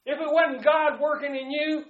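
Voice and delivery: loud, high preacher fervor; fervent preaching. Acted. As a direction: preach grace with full force. Preaching at full force.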